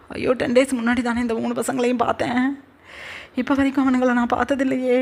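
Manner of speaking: anguished lament. Acted. [name] alone on the floor, wailing in panic. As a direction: let the shock spiral up into a wail.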